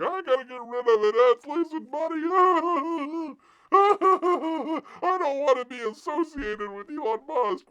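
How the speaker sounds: very high, loud, drawn-out wail; hammed-up hysterical Tesla owner, high and slow with a laughing wail; mock panicked whine. Host mocking Tesla owners desperate to sell, hammed-up panicked whine.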